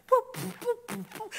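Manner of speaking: high playful boop noises; silly high sound effect for flighty people; bouncy boops. Retold or imitated. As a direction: pop each boop up high like something flitting. Mimics people flitting like wind.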